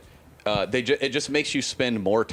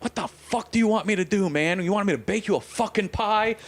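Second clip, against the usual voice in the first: put-on high, angry old-man outburst, muttered to himself; crotchety old man ranting; 10 semitones higher than usual. Nursery worker ranting to himself after hanging up, high and furious. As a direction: play a grumpy nursery man fuming after a phone call. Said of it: "a kind of a crotchety old man".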